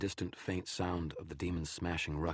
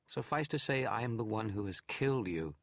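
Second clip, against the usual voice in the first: higher, scornful challenge from a hidden enemy; taunting shadow, scornful and raised; 7 semitones higher, 3 dB louder than usual. Acted. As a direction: sneer it down from the dark. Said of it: "the shadow scorned".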